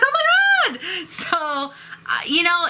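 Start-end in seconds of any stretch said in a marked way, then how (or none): 0.0-0.8 very high excited squeal. Shown as a demonstration. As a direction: squeal it when thrilled. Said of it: "I get really excited".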